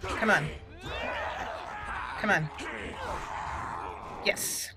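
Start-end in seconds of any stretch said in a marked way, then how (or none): none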